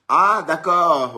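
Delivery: loud, high, slow ah of realisation; drawn-out realisation. Real, not acted. Streamer reacting to a viewer's comment, surprised and drawn out. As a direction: stretch out the ah as it suddenly clicks.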